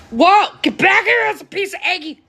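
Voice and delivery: grab for it with a high yelp; startled call. Real, not acted. Chasing food that slips away.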